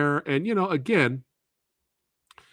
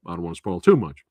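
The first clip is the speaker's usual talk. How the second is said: usual speaking voice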